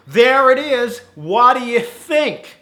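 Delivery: high, loud, stronger American manager; American accent. Acted. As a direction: present it in broad mock American, slowly. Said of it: "His accent goes in and out".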